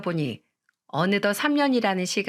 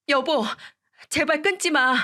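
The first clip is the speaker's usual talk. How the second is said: desperate plea; 7 semitones higher than usual. A narrator's reading. Ex-wife begging ex-husband not to hang up, desperate and higher. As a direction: beg him not to hang up.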